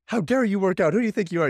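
high, loud hammed-up indignation; mock indignant outrage. Husband imitating the outrage his wife didn't show. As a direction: ham up a scandalised wife's outrage. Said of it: "she wasn't like".

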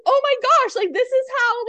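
high, loud exasperated outburst. Retold or imitated. Mom venting about friends who cancel, exasperated.

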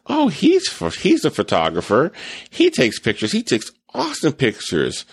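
imitating a proud gushing girlfriend, starting high; mock-gush over his pictures; a playful take on an eager partner bragging. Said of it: "and then Nancy says".

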